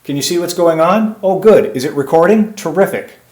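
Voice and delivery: high, loud fake conversation with a helper; pretend phone chat. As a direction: act like someone is on the line. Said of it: "You can talk to someone".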